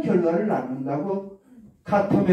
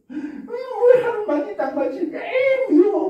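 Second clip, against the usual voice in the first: put-on much higher shouting child voice near tears; upset child; slower than usual. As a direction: shriek it like a hurt kid. Acted child answering angrily. (울먹이며 소리치는 아이)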